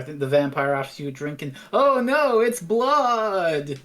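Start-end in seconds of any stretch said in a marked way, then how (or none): 1.7-3.8 high, loud melodramatic dismay; hammed-up shock, stretching the last word; mock horror. Dinner guest finds blood in the drink, mock horror. Acted vampire trope victim.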